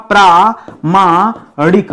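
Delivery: प्रामाणिक drawn out slowly, syllable by syllable; slow spelled-out word. Shown as a demonstration. Teacher to students, drawing the word out slowly. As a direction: stretch प्रामाणिक so students hear each sound. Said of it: "प्रामाणिक शब्द होगा".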